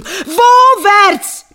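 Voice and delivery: loud, high desperate question; demanding. Acted. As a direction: demand which way, frantic. Husband demands the direction. (Mann fragt verzweifelt laut nach der Richtung)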